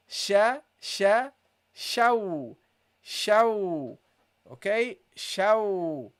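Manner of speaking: sha, sha then rounded shaw; teacher drills the letter sheen; Arabic sheen. Shown as a demonstration. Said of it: "that's how you pronounce it".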